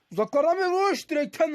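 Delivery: high, sudden call of a shot; excited commentator. Real, not acted. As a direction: call the long shot with a rush. Long shot hits the post.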